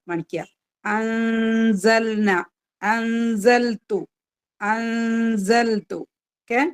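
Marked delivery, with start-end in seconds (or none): 2.8-6.0 slow drawn-out Arabic recitation of അൻസൽതു; Quran recitation demo. Shown as a demonstration. Teacher reciting the word for students, slow and drawn out. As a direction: chant അൻസൽതു slowly, stretching each vowel. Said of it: "ആ ഒരു വാക്ക് പാരായണം ചെയ്യുന്നത്".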